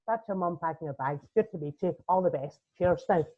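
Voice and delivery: brisk sign-off, lightly Northern Irish. Retold or imitated. Sister dismisses the family, a hint of brisk Northern Irish. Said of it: "as Northern Irish as they come".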